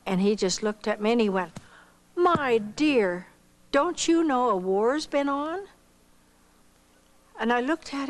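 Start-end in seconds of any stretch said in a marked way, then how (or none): 2.2-5.7 higher, slow gentle chiding man; kindly clerk. Retold or imitated. Clerk gently chiding a young woman, higher. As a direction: tell her kindly she should know.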